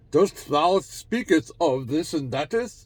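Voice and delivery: high, loud, slow mock-biblical proclamation; mock archaic speech. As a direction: parody a pompous old-time oracle. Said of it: "That kind of language doesn't work anymore".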